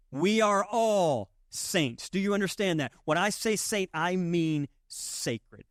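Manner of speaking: preacher drawing out the core truth very slowly; slow, deliberate emphasis. Real, not acted. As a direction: lay each word down slowly. Preacher slows for the key line.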